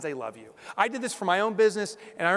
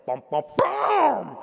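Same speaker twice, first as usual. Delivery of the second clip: slow, booming bass line, higher and louder; sung bass notes; 6 semitones higher, 5 dB louder, much slower than usual. Retold or imitated. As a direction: hum the opening bass like a rock song. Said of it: "it goes something like this".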